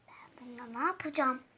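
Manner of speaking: muttered whisper. Acted. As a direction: whisper it aside. Whispered aside.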